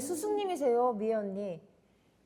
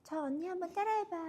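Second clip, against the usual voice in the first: imitating senior; 8 semitones higher, 9 dB quieter than usual. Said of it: "이렇게 하셨어요".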